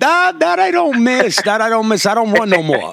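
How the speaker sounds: emphatic protest. Real, not acted. Swearing to co-host the running days are over, emphatic protest. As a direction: protest it high, easing down as you repeat.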